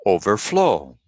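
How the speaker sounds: overflow with FLOW stressed; overflow, weight on the final syllable; stress on second part. Shown as a demonstration. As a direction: rise onto FLOW in overflow, then fall. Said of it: "always the second word".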